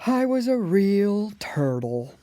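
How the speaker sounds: sighing turtle. A narrator's reading. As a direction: heave it out on a long sigh. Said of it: "with a deep sigh".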